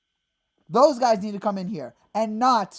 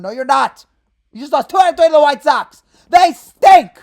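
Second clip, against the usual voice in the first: rising to a loud, higher shout; fan's disgusted yell, louder and higher; angry retort; 4 semitones higher, 12 dB louder than usual. Real, not acted. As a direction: snap back and build to yelling. Fan rejecting team's claims.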